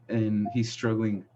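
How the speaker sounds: ordinary talk, nothing marked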